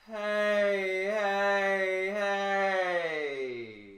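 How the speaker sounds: loud, high, sustained heys; amplified resonant call. Shown as a demonstration. Voice coach shows how resonators amplify, ringing resonant call. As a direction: ring the heys out in one long linked wave. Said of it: "amplified by these resonators".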